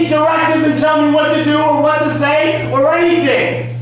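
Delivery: real furious shout, pitched high; angry shouting. Real, not acted. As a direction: yell back at the officer in open defiance. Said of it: "How come you're getting angry?".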